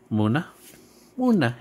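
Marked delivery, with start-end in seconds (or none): 1.2-1.6 much higher, sudden call of the name; high excited call. Acted. Calling out to a Munna card.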